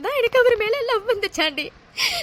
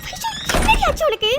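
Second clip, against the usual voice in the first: frightened friend begging for answers; anxious rising plea; alarmed; 9 semitones higher than usual. Acted. Friend panics at her crying.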